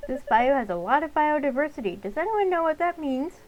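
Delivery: a cheerful field-trip teacher asking the class. Acted. Teacher character addressing the class.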